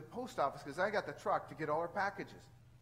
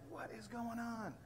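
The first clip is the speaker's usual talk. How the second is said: a high, soft, slow exasperated question; 5 semitones higher, 7 dB quieter, slower than usual. Retold or imitated. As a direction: ask it in weary disbelief. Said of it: "So I'm like".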